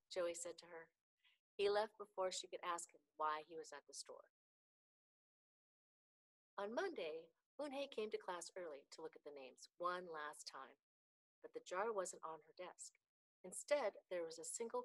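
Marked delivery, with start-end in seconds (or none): none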